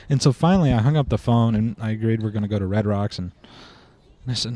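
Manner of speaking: normal talking voice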